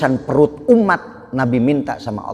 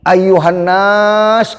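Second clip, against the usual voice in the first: louder and higher, drawn out very slowly; calling out; 6 semitones higher, 7 dB louder, much slower than usual. Acted. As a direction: call the crowd with a long, slow summons. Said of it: "Nabi teriak lagi".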